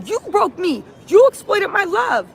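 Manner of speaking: high, indignant mock complaint; acted accusation. Retelling the fight as Jane fusses back, mock accusation. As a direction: imitate a wounded accuser in a quarrel. Acts out the screamed quarrel.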